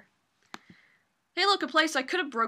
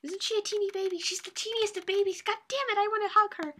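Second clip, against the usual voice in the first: gushing over cuteness; 8 semitones higher than usual. Real, not acted. Player adoring a cute game character, high-pitched gushing. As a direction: coo higher and higher with adoration.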